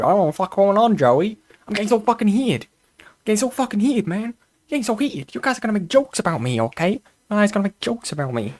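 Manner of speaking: hammed-up New Jersey wiseguy accent, rattled off; mock a heated caller as a Sopranos mobster. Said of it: "like a fucking Sopranos character".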